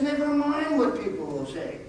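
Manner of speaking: high-pitched imitation of a big man; squeaky voice. Family friend waving away the farmer's worry, imitated squeaky voice. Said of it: "the squeaky voice, he says".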